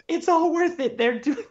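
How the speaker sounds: high, excited squeal. Real, not acted. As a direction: squeal with delight. Excited realization.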